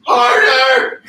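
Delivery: loud slow coaching yell; encouraging shout. Real, not acted. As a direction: push them on with a shout. Coaching during a workout set.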